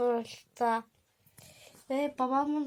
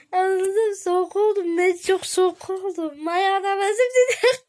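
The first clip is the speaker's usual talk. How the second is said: high, loud wail through tears; crying shout; 6 semitones higher, 9 dB louder than usual. Real, not acted. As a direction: cry it out much louder and higher. Child suddenly shouting, crying.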